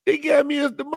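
high, laughing imitation of the guy; giggling mock quote, high-pitched; quoting through laughter. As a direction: squeal it out while cracking up. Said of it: "he said".

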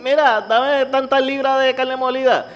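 high, slow put-on voice of a pushy old customer; demanding old lady. Mocking the old customers at the meat counter, high slow put-on voice.

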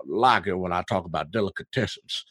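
low, put-on Western voice. Shown as a demonstration. Podcast host describing his sandwich bit, low Western voice. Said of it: "I do my Western voice".

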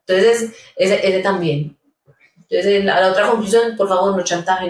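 ordinary talk

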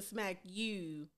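slow, exaggerated "ooh" in "you"; "you" with a drawn-out mock accent vowel; mocking ooh sound. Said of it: "words with the ooh sound".

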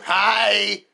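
high, loud eager greeting; creepy little daughter. Retold or imitated. Daughter greets dad entering the room, high and loud. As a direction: chirp a manic hello.

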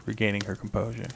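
ordinary delivery

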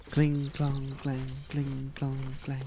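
even, monotone metallic clinking; soft, ringing cling-clang on one note; imitated clanging. A narrator's reading. Storyteller imitates knights' swords for listening children, ringing clangs.

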